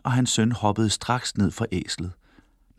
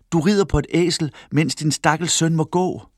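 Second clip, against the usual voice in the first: higher-pitched scolding, not a full shout; calling out; 7 semitones higher than usual. A narrator's reading. Wife scolds a father riding while his son walks, calling out. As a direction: raise the pitch in reproach. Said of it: "råbte hans kone".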